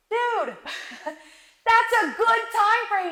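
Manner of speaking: high, slow words broken by laughter; laughing. Retold or imitated. Laughing while telling the account holder it was a good time frame. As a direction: laugh through it at the rep's excuse. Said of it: "busting out laughing".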